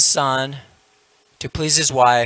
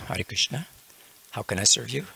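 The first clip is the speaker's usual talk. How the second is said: meek wife; 8 dB quieter than usual. Retold or imitated. Wife appearing with folded hands, meek and soft. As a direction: offer yourself softly and humbly. Newly created wife offers to serve.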